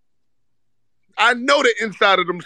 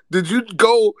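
ordinary talk

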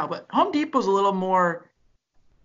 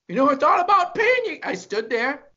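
Bill Burr impression; 6 semitones higher than usual. Comic ranting about walking out unpaid, mock outrage. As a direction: rant like Bill Burr, squeaking up at the end. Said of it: "Bill Burr's bit".

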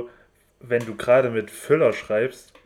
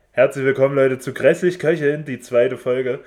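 upbeat louder host welcome; podcast host intro; 3 semitones higher, 5 dB louder than usual. Acted. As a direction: greet the listeners like a show host. Podcast show opening.

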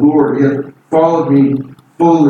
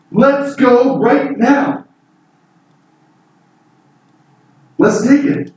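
eager call to go; 7 semitones higher, slower than usual. Retold or imitated. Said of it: "Caleb's response was".

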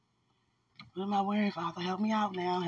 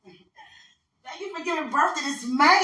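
grateful; 9 semitones higher, slower than usual. Retold or imitated. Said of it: "I'm like".

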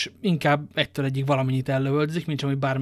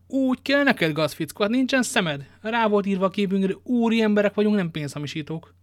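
higher, scornful scolding; 6 semitones higher than usual. Acted. D'Artagnan scornfully scolds the innkeeper, higher. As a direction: scold the rascal with contempt.

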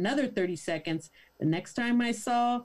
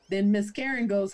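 ordinary talk

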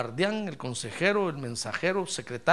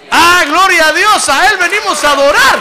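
full-force preaching; 9 semitones higher, 13 dB louder than usual. Real, not acted. Pastor shouting praise to God to his congregation, full-force preaching.

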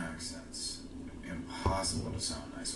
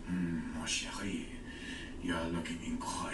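lightly put-on French accent; 3 semitones lower than usual. Man approaching a woman, seductive French accent. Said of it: "Guy comes up to you in a French accent".

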